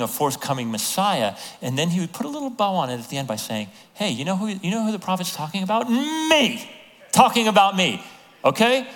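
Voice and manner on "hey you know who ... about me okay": high, rising, loud on Me; spring the punchline with glee; Jesus gleefully revealing himself to the synagogue; excited reveal. Retold or imitated. Jesus voiced as playful show-off.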